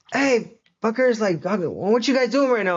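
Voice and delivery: animated mock greeting; hyped-up homie. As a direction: imitate your pal hailing the guys. Acts out friend Lester pitching idea.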